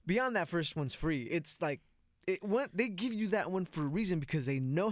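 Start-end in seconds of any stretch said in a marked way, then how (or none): none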